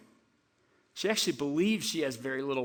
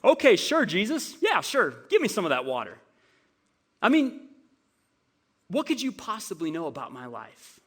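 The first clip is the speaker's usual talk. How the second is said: sarcastic woman; 3 semitones higher, 4 dB louder than usual. Retold or imitated. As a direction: scoff at every word he says. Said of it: "I think sarcastically, again, she goes".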